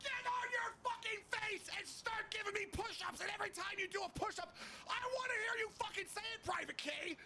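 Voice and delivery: very high far-off yell; distant shouting sergeant. Retold or imitated. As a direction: shout it from far outside the truck. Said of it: "I hear the sergeant saying".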